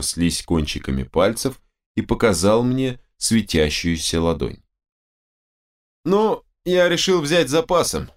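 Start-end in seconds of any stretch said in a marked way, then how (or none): 6.0-8.1 high, put-on carelessness; casual, hiding shakiness. A narrator's reading. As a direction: toss it off while fighting a tremble. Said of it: "небрежно отозвался я, борясь с дрожью в голосе". (нарочито небрежно, скрывая дрожь)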